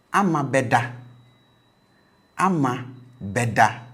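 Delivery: "Ama beda" with a high tone on be; future tone demo. Shown as a demonstration. Teacher models "Ama beda" with be high. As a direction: say "Ama beda" with be high, meaning will sleep. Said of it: "Ama will sleep".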